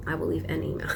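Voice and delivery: quietly repeated to herself. Shown as a demonstration. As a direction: say it again under your breath.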